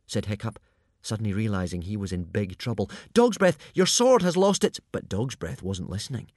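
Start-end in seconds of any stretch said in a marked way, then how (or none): 3.1-4.8 loud, high, urgent call; high-pitched alarm; alarmed warning. A narrator's reading. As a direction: shout a warning to stop an attacker. Urgent warning to a charging attacker.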